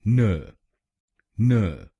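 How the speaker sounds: न said alone as a short nə; syllable demo. Shown as a demonstration. Teacher drills the third syllable. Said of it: "एनए हम बोलेंगे".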